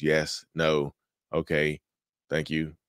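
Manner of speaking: low, bare one-word replies; bland answers. Acted. Gray-rocker replying to a narcissist, bland and boring. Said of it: "You're just giving them bland answers".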